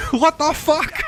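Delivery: yelling in shock; shocked outburst. Real, not acted. Ox cart knocks him off.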